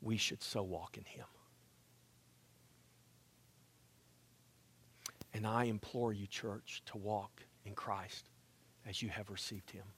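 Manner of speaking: whispered, soft and low, drawing the church close; hushed plea. Real, not acted. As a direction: lean in and plead almost under the breath.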